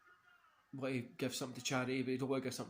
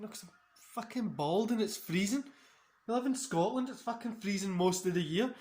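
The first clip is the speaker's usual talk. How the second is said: higher, louder fed-up rant; exasperated retort; 8 semitones higher, 7 dB louder than usual. Retold or imitated. Streamer retells answering a dad, loud fed-up rant. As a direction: vent about the cold to the dad. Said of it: "I was like".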